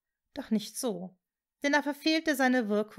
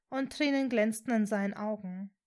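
ordinary delivery

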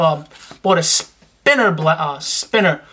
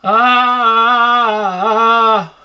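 sung yell; 3 semitones higher, 4 dB louder than usual. Shown as a demonstration. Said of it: "Scream like this".

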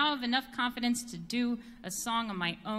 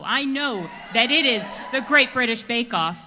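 louder, insistent claim; emphatic; 11 dB louder than usual. Real, not acted. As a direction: declare it like a fan. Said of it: "here's my thing".

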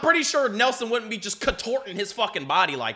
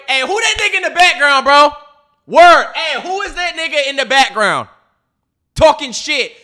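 loud, high, affronted challenge; confrontational; 5 semitones higher, 11 dB louder than usual. Real, not acted. Viewer challenging the trash talker in the background, confrontational. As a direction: call out the heckler at full voice.

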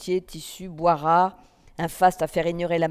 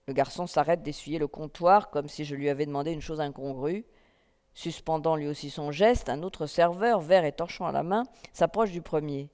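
ordinary delivery, nothing marked

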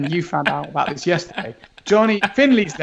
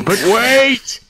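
trailer twist; 6 semitones higher, 6 dB louder than usual. Pretend.

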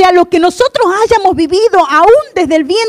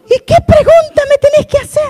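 much higher, curious mother; mother's voice; 9 semitones higher than usual. Retold or imitated. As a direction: ask it as a puzzled mom. Pastor voices her mother answering. (voz de madre intrigada, mucho más aguda)

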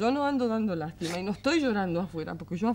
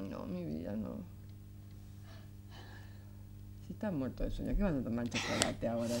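soft, low bedtime voice; gentle refusal; 12 semitones lower, 10 dB quieter than usual. Acted. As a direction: turn a sleepy child down quietly and tenderly. Mother gently refuses sleepy son.

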